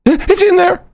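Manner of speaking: high shouted mock panic; high panicked wife shouting at the guard; frantic wife. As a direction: shriek it as a flustered wife. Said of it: "She's like".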